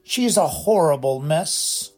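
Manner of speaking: butter up the headmaster while gloating; oily voice. A narrator's reading. Poltergeist toadying to Dumbledore, oily and high. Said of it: "he adopted an oily voice".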